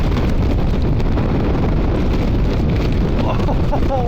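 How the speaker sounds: high, slow astonished exclaiming; amazed disbelief. Real, not acted. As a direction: gasp out disbelief at the hail. Hail pelting him.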